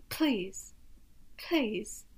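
imitated breathy "Please"; mock an over-aspirated P on "Please"; breathy P's. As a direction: puff out the P in "Please". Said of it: "it was so breathy".

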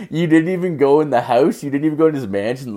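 high, laughing, can't believe it; laughing disbelief. Real, not acted. Mocking his buddy over the guest house, laughing. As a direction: laugh through the question.

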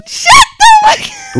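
high, loud mock yell at a friend; squealed shut up; laughing shout. Real, not acted. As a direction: yell it back, half laughing. Playful retort to teasing.

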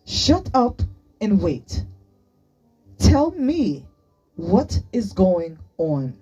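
loud, slow, emphatic order; yelled demand. A narrator's reading. Sunny demanding an explanation from both friends, yelling. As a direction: yell each word out slowly. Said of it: "Sunny yelled loudly enough that they both jumped".

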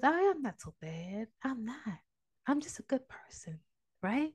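softly voiced, coaxing snake; innocent-acting snake. Snake assuring the man it is good, softly coaxing. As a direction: play it soft and disarming, as the snake.

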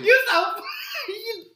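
high squealing laughter; cracking up. Real, not acted. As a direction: laugh through the words. Cracking up at friend.

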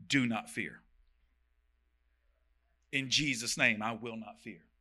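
low, soft declaration of faith; steady yourself under your breath; quiet self-talk. Shown as a demonstration. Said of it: "you can say".

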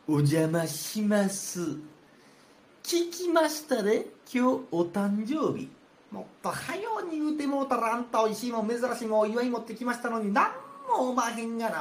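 swagger in like the know-it-all guest; conceited Take. Acted.

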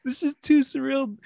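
laughing. Retold or imitated. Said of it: "I was laughing".

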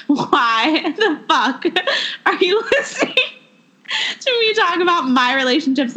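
laughing through the words, voice jumping high; can't keep a straight face while asking. Real, not acted. To listeners, laughing in disbelief.

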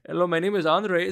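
a hint of an Indian call-centre accent; mock Indian accent. Caller mimicking call-centre agent, light Indian accent. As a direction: slip into the agent's accent as a joke. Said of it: "Am I gonna do an accent?".

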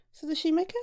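ordinary delivery, nothing marked

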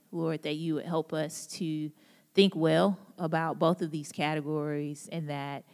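quiet, reverent opening prayer. Acted. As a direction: pray softly before the talk. Opening prayer before the talk.

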